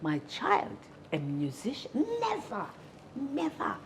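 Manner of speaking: playing her outraged mother refusing flatly; shocked mother. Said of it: "my mother was like".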